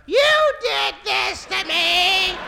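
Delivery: high, drawn-out, slow screech at him; wife's furious accusation. Retold or imitated. Wife in labor turns on her husband, high slow accusing cry. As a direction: stretch each word high in accusation.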